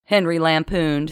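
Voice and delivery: plain reading voice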